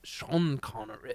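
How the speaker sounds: tentative, soft Connery imitation; Sean Connery. Shown as a demonstration. Co-host attempts it when pushed, soft slow Connery impression. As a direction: try a slow, quiet Scottish burr. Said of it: "Do Sean Connery. Try it".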